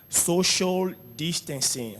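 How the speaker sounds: slow "social distancing"; English phrase. Shown as a demonstration. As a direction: model "social distancing" for pupils. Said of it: "Répétez après moi". ("social distancing" lentement)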